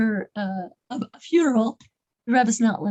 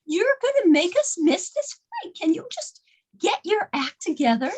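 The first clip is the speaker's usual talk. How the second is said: higher-pitched mock exasperation; retold fury; 7 semitones higher than usual. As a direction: retell an angry passenger losing her cool. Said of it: "she got furious. She lost her cool".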